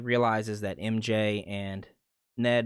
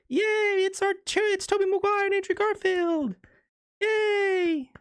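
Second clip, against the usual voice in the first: very high squealing falsetto cheer, drawn out; mock cheering; 4 dB louder than usual. Imitating fans cheering Tobey and Andrew's arrival, mock squealed cheer. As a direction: squeal like a hammed-up adoring crowd. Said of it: "Let's all cheer".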